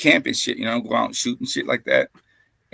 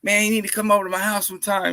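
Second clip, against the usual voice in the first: put-on inviting old friend; 6 semitones higher, faster than usual. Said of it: "he's like".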